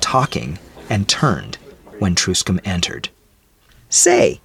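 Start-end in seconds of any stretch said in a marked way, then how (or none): 3.9-4.4 much higher, louder storekeeper exclaiming. A narrator's reading. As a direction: call out to get his attention. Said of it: "exclaimed the storekeeper".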